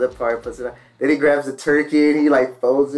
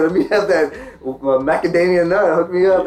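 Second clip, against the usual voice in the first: eager request; 6 semitones higher, 5 dB louder than usual. Retold or imitated. Customer asking for cookie.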